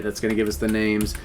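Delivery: normal talking voice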